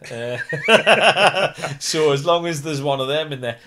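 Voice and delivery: laughing through the words. Real, not acted. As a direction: chuckle while you say it. Laughing at his own joke.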